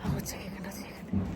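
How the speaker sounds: breathy hush, barely audible; soft shushing whisper to her husband. Real, not acted. Kids still asleep, hushing.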